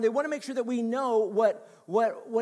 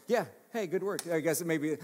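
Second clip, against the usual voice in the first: normal talking voice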